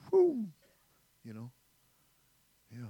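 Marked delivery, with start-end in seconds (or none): 0.1-0.5 a high whooshing whoo. Retold or imitated. Whooshing past the missed stop, high whoo.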